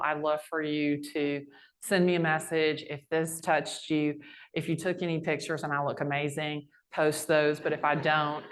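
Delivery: normal talking voice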